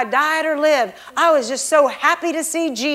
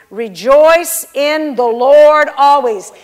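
loud, drawn-out declaration; emphatic scripture reading; 8 dB louder, slower than usual. Retold or imitated. Preacher reading the verse from the bedside Bible, loud and emphatic. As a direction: proclaim it loudly and slowly.

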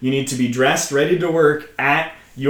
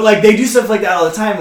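ordinary delivery

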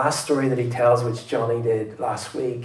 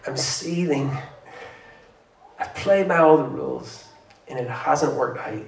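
put-on resentful older brother; 5 semitones higher, 8 dB louder than usual.

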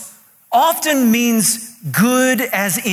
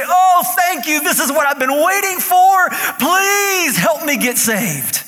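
shouted, much higher, hammed-up thankful cry; mock overjoyed gratitude; 8 semitones higher than usual. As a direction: ham up a convert begging to be saved, slowing at the end. Mock grateful convert falling on knees.

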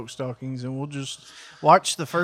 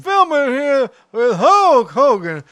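Corey Macklin; 9 semitones higher, 8 dB louder than usual. Acted. Man imitating a wrestling host announcing a match, booming. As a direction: belt it out like a ringside TV host. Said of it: "I was just doing a Corey Macklin".

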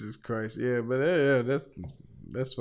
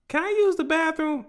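put-on much higher, louder child voice; little girl asking; 5 dB louder than usual. Girlfriend's daughter interrupting, much higher child voice. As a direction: play the innocent kid interrupting. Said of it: "then her daughter came downstairs".

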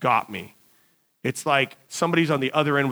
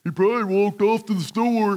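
higher, put-on workmate's voice; imitated coworker voice; 5 semitones higher than usual. Coworkers guessing where the kid went, mimicked higher voice. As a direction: mimic how the crewman really talked. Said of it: "that's how they really talked".

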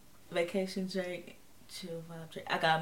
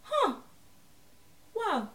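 impressed surprise; 12 semitones higher than usual. Retold or imitated. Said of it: "are kind of like".

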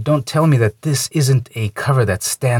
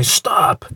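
"Stop!" punched higher as a demo; emphatic demo; 5 semitones higher than usual. Shown as a demonstration. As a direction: show the overdone emphasis on "Stop!". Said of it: "I know for emphasis".

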